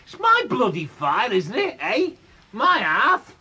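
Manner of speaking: defiant snarl. Acted. Drunk father snapping at his wife over the fire. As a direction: bristle and claim the hearth as his.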